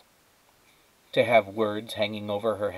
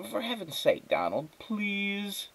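high, soft, slow exasperated please; 11 semitones higher, 5 dB quieter, slower than usual. Acted. Wife pleading with Donald, high and exasperated. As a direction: beg him to stop, worn out.

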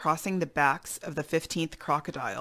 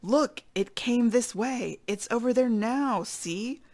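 high, drawn-out cry of alarm; boar's contained scream; mild storybook scream; 6 semitones higher, slower than usual. Acted. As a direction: raise the alarm, pitched up. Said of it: "he heard a wild boar scream".

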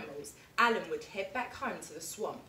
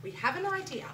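Frog's offer; 10 semitones higher than usual. Acted. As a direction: chirp the offer brightly. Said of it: "said Frog".

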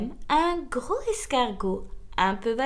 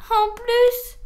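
high, loud, put-upon lament; sighing lament; 9 semitones higher, 9 dB louder than usual. A narrator's reading. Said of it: "soupirait-elle".

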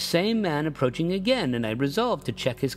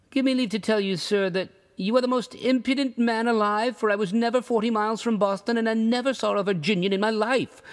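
put-on higher, affronted old traveller; indignant rebuke; 8 semitones higher than usual. A narrator's reading. Rugg scolding the narrator, indignant and raised. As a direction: tell him off as impudent.

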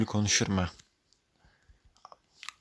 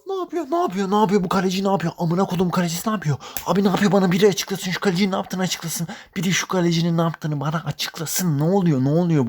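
high-pitched disbelief; 8 semitones higher than usual. Real, not acted. Keeper blunder, repeated disbelief.

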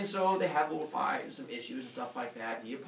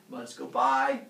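ordinary talk, nothing marked

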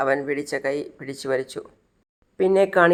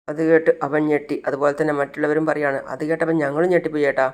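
plain reading voice

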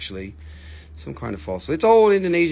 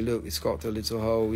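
normal talking voice

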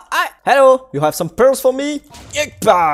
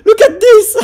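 much higher, louder, laughing through it; laughing with delight; 9 semitones higher, 5 dB louder than usual. Real, not acted. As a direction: burst out laughing in disbelief. Amazed at own build.

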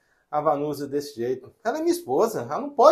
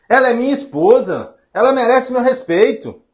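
raised, louder insistence; firm defiance; 6 semitones higher, 6 dB louder than usual. Acted. Son defending his wife to mother, firm and raised. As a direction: stand up for her, pitched high and forceful.

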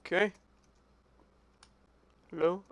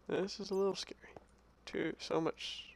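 very soft, wary whisper; nervous whisper; 19 dB quieter than usual. Real, not acted. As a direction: whisper as if scared of being heard. Nervous in a scary cave.

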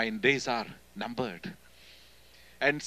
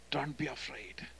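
much softer "Don't be afraid", gently echoed; soft repeat; 10 dB quieter than usual. Retold or imitated. Jesus softly repeating his comfort, much quieter. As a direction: repeat it as a tender hush.

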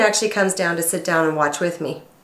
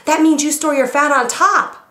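high, louder backpedaling husband; husband insisting it's a compliment, much higher; flustered husband; 9 semitones higher, 4 dB louder than usual. Retold or imitated. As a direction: hurry to save yourself. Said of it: "He goes".

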